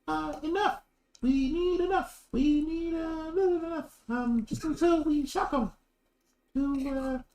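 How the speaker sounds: very high, slow cultist voice; squeaky creepy chant, drawn out; high strange voice. Acted. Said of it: "that one person goes".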